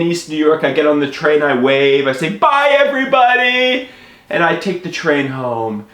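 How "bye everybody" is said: high-pitched cheery call; calling goodbye. Retold or imitated. Storyteller waving from the train, high cheerful call. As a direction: call out a big farewell.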